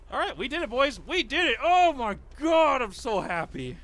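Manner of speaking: high and loud celebration; excited. Real, not acted. Gamer shouting to the team they did it, cheering.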